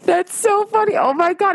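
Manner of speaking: high, laughing squeal; real laughing delight. Real, not acted. Laughing at troll story.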